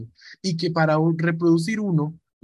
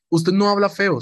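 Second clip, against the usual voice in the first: ordinary talk, nothing marked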